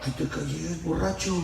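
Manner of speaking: imitated soft reprimand; hushed scolding. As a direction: quietly shush a drunk, keeping it discreet. Deacon shushes the drunk again.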